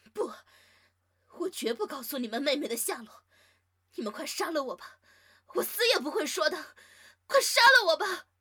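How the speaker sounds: very high, loud tearful defiance; defiant crying shout. Acted. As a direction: scream your refusal through sobs. Captive refuses, defiant outburst.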